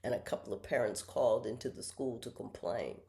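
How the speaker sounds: ordinary talk, nothing marked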